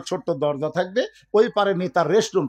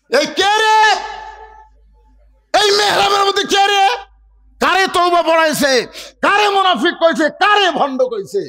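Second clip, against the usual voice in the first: very high, shouted angry demands; crowd clamour; 9 semitones higher than usual. Acted. Thousands of companions demanding who was insulted, shouting. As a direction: yell each question out, outraged. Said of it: "সমস্ত সাহাবী যখন চিল্লা চিল্লি".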